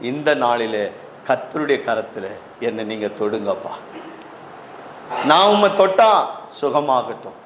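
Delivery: low, subdued pastoral voice; prayerful appeal. Real, not acted. Pastor inviting congregation to touch the Lord for healing, prayerful. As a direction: bring them gently down into prayer.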